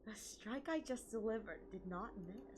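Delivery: soft unvoiced whisper. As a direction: whisper the line menacingly. Echoes villain line in a whisper.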